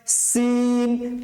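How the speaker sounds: "সিন" with a thin s like leaking air; punctured-tyre hiss. Shown as a demonstration. Teacher showing the tyre-puncture sound, thin drawn-out "সিন". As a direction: hiss the s in "সিন" and hold the long ee. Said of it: "tyre পাঞ্চের যেরকম আওয়াজ হয়".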